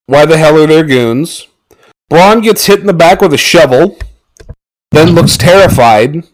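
normal talking voice

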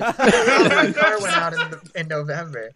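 high, loud oh breaking into a laugh; sudden sheepish realisation; mock surprise. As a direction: caught out and amused. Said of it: "And I was like".

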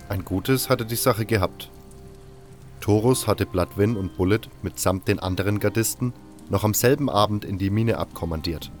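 plain reading voice

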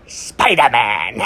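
cackle, then slow drawn-out Spider-Man, then cackle; Green Goblin cackle. Retold or imitated. Vlogger imitating Willem Dafoe's goblin, hammed-up cackle. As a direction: ham up a villain's gleeful laugh around the name. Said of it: "you can hear Willem Dafoe doing his goblin cackle".

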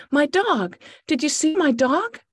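high, loud, anxious asking; urgent search for a lost dog; frantic. Acted. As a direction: plead with strangers for help finding him.

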